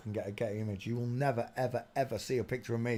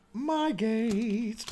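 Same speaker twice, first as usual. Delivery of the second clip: mock high-pitched repeat; high silly voice; 10 semitones higher than usual. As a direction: squeak it in a goofy high voice.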